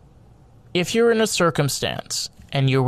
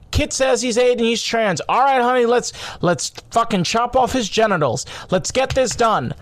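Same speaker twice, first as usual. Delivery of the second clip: a lightly voiced breezy parent, pitched higher; mocking woke parent; 8 semitones higher than usual. Podcaster parodying careless parents, mock-breezy and higher. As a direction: toss it off like an absurd parody. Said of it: "the wokeness of".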